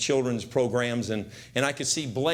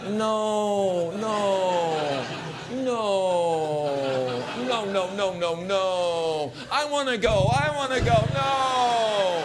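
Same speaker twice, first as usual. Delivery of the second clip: desperate pleading; 4 semitones higher, much slower than usual. Pretend. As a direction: beg not to be left behind, frantic. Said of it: "it's like".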